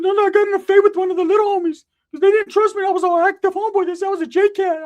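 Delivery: high cartoonish whine of excuses; squeaky Ricky. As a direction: squeal it like a beat-up kid. Ricky voiced, beaten up.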